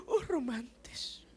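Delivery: gasping, slow, breathy whisper; mock sentimental swoon. Tough man watching Korean film, mock tearful swoon. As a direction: swoon as if moved to tears. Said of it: "Saya lihat film Korea bisa nangis".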